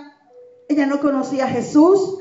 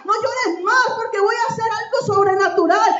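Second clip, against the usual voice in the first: the Lord comforting with tears in the voice; tearful preaching as the Lord; 6 semitones higher than usual. Acted. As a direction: build each no llores más with emotion. Voicing the Lord, crescendo.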